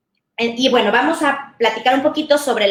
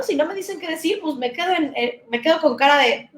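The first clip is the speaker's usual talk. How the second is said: mock clueless teenager; 6 semitones higher than usual. Imitating a stuck teenager.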